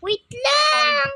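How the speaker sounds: high, loud shout; urgent shout. Real, not acted. Stops brother interrupting.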